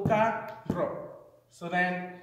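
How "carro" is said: "carro" split into two syllables, pitched up; higher, divided "carro" for a class. Shown as a demonstration. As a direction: sound out "carro" in two separate parts. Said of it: "one syllable".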